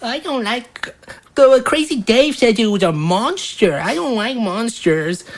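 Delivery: small voice, wary of monsters; timid little child. Acted. Game master voices small child.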